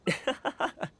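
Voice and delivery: breathy amused chuckle; a short flattered laugh; light airy laugh. Real, not acted.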